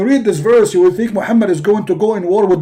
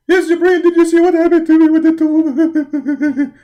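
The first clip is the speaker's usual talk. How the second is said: higher and louder, anxious pleading; whiny prophet; 6 semitones higher, 5 dB louder than usual. Acted. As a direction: rush out the worry, louder. Muhammad complains to Jibreel.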